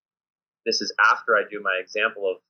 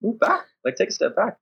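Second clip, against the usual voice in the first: higher, eager order to step back; playful command; 10 semitones higher than usual. Retold or imitated. Coach to improving players, eager higher command. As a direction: push them back with playful excitement. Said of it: "You're like".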